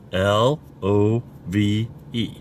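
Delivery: slow, each letter set apart; spelled out letter by letter. Shown as a demonstration. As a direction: spell L O V E out slowly. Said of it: "I'm gonna say it in four letters".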